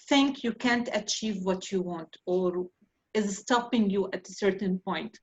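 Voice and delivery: ordinary talk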